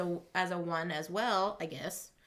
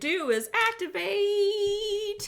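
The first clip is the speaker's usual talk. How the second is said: higher, half-sung undertaker voice; 7 semitones higher than usual. Acted. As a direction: sing it like a grim undertaker. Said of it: "That was my Undertaker tone".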